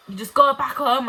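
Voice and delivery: retold hostile order. Old woman telling a child to leave, loud retold order. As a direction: act out a loud, dismissive order.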